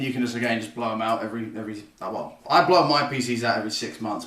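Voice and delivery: ordinary delivery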